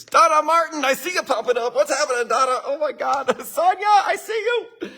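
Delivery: high, delighted greeting by name; excited shout-out. Real, not acted. Presenter greeting attendees as they pop up, excited and high-pitched. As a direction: spot familiar faces and greet them in a high, gleeful voice.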